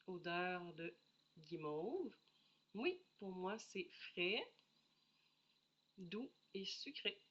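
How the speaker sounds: ordinary delivery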